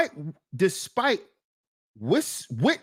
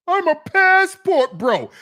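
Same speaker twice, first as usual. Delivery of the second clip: higher, louder mocking boast; mock the rapper's proud claim; 7 semitones higher, 5 dB louder than usual. Vlogger mocking [name]'s passport bro boast. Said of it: "Should be ashamed of yourself".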